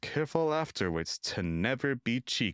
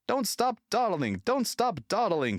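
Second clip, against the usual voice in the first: grumbling; 12 semitones higher than usual. A narrator's reading. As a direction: grumble back at the coaches. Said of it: "he grumbled".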